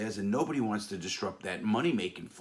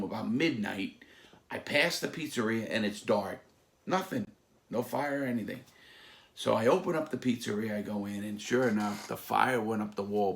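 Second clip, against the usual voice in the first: normal talking voice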